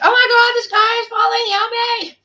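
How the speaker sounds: high, loud, hammed-up panicked cry; shrill-pitched cry for help, played for laughs; mock panic. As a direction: wail like the sky is coming down. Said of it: "when things go bad, it's".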